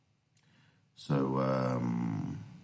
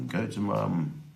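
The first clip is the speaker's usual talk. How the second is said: higher-pitched quoted voice of relatives; retold family instruction; 7 semitones higher, slower than usual. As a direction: lightly imitate a relative sending a child off.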